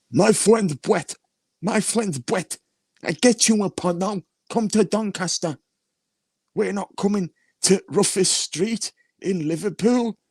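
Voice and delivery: high, drawn-out mocking voice; mocking impersonation. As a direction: mimic a rival's challenge in a silly high-pitched voice. Mocking someone's challenge in acted quote.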